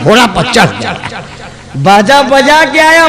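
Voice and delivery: ordinary delivery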